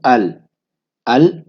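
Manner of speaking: अल with the lam clearly sounded; pronounced lam. Shown as a demonstration. Teacher shows the moon-letter lam, clearly sounding अल. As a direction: say अल, fully voicing the L. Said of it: "मैं लाम का तलफ़ुज़ अदा कर रहा हूँ".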